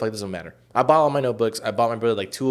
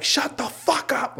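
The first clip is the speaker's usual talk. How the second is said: very high, drawn-out mock yell; livid mom, imitated; much slower than usual. Mom silencing brother talking over him, imitated fury. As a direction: stretch it out as a fed-up mother. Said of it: "she was just so fucking livid".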